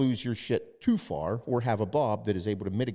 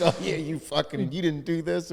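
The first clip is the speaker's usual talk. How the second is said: higher, jeering commenter voice; mock commenters; 7 semitones higher than usual. Commenters bashing a beloved brand owner, jeering. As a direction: sneer it like an online troll.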